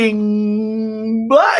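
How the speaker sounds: slow, drawn-out know-it-all guess; clueless tourist. Retold or imitated. American guessing someone's accent, slow and drawn out. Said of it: "And you go".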